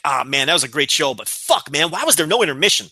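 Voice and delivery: low, mock-disappointed fan quote. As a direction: sarcastically play the let-down fan. Mocking imagined disappointed fan.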